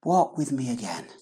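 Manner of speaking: soft, tender invitation; softly pleading Jesus; gentle Jesus voice. Acted. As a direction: coax them back quietly. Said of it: "Jesus says".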